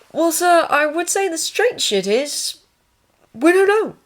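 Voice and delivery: high, slow, careful orderly; hesitant orderly, measured and high. Acted. Voices the orderly.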